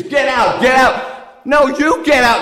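shouting. Retold or imitated. Couple screaming get out at each other, loud. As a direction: throw them back and forth in a row. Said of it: "she screams".

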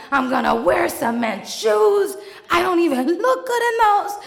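high, loud, laughing panic; hammed-up hysterical outburst; frantic rant.

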